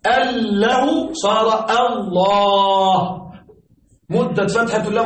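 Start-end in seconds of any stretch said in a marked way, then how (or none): 0.1-3.2 ال له said apart, then صار الله with a long drawn a; slow demonstration. Shown as a demonstration. Teacher showing students how ال له becomes الله, said very slowly.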